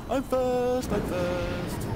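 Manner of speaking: excited. Real, not acted. Leading the race.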